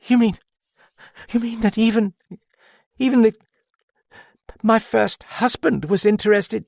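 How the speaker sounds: high and breathless, near-hysterical, laughter about to spill over; a queer voice teetering toward mad laughter; on the edge of mad laughter. A narrator's reading. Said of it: "in a queer voice that hovered on the edge of mad laughter".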